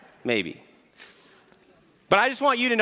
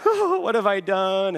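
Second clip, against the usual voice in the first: mock despair; much slower than usual. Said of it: "I just cried on my steering wheel".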